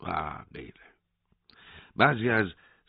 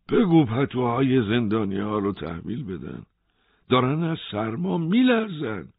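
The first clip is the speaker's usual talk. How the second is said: high, raised plea for the blankets; urgent demand; 7 semitones higher, 4 dB louder than usual. A narrator's reading. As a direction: press the major hard. Hossein demands blankets from major. (درخواست بلند و فوری)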